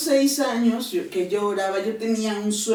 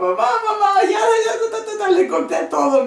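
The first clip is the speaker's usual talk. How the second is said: much higher, louder, near shouting; frantic excitement; 12 semitones higher, 7 dB louder than usual. Retold or imitated. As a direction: call out to mom like a madwoman. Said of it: "como loca". (gritando emocionada a su mamá, como loca)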